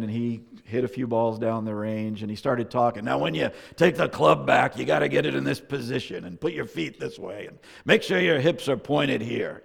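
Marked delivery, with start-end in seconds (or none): 3.0-9.6 put-on higher, louder New England old-man voice, a bit gruff; walk through the swing like a gruff New England grandpa; gruff New England coaching. Great-grandfather explaining the swing to a bewildered kid, in a gruff New England accent. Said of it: "in his gruff New England accent".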